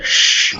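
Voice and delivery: isolated "sh", a voiceless hush; sh sound. Shown as a demonstration. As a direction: demonstrate "sh" alone, tongue drawn back. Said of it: "the difference between S and S H".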